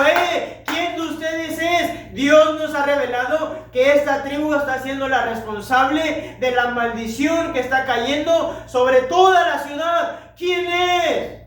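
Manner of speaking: high, loud, slow questioning; demanding interrogation. Acted. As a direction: demand the culprit step forward. Josué interroga a la familia. (Josué exigiendo al culpable en voz alta)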